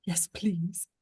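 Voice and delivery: usual speaking voice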